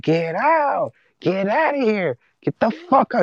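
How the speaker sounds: high, drawn-out mock yelling; retold screaming. Neighbor throwing partner out at night, mock screaming. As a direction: imitate a screaming neighbor, pitch way up, not full volume. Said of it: "like, a loud scream".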